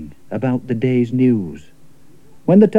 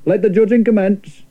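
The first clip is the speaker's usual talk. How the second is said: higher, grand official announcement; Lord Mayor proclaiming; 7 semitones higher than usual. Acted. Lord Mayor ending the contest, higher pompous proclamation. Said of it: "the Lord Mayor exclaimed".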